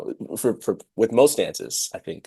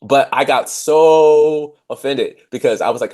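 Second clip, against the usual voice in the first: ordinary delivery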